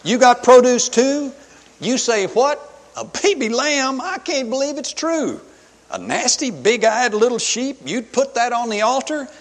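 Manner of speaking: loud, high, incredulous mockery; scoffing Cain. Cain mocking Abel's lamb offering, incredulous and loud. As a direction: scoff at a rival in bouncy rhyme. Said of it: "this is the way it would have sounded to me".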